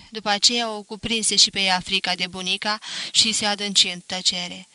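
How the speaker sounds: plain reading voice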